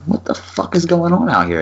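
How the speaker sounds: high-pitched mock bewilderment; imitating bewildered wife. Husband imitates the missus finding the commotion, high and baffled.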